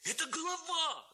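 «Это голова!» yelled high and loud; alarmed shout. A narrator's reading. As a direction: scream «голова» as they scatter. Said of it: "закричали хлопцы". («Это голова!» пронзительно и громко)

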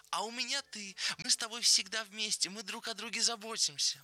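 high-pitched, triumphant exclamation; exultant, lifted high. A narrator's reading. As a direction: proclaim it proudly in a high voice. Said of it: "воскликнул Леня с торжеством".

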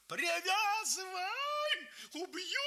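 very high, drawn-out furious yell; wild yell. A narrator's reading. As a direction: roar it in rage. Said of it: "Помню дикий его крик".

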